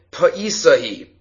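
ordinary talk, nothing marked

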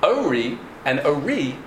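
first Ori stressed on "O", second Ori on "ri"; contrasting syllable stress. Shown as a demonstration. Teacher separating two different words, Ori stressed first then last. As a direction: move the stress from start to end between Ori and Ori. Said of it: "where you put the stress changes the word".

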